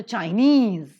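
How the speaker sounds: Chinese, second syllable drawn out and punched; stress on second syllable. Shown as a demonstration. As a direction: lean hard on the end of Chinese. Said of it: "the second syllable is accented".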